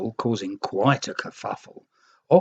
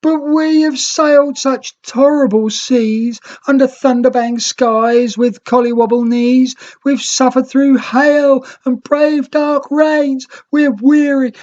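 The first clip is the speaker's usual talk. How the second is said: woeful pleading; 10 dB louder than usual. Acted. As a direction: beg for pity after a hard voyage. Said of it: "the greens sobbed woefully".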